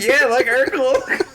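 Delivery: high, slow, laughing disbelief. Retold or imitated. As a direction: laugh the words out. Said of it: "I'm like".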